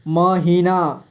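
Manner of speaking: महीना split into म, ही, ना; syllable by syllable. Shown as a demonstration. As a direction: draw out each syllable of महीना. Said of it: "थोड़ा fast पढ़ेंगे". (महीना को तोड़कर: म, ही, ना)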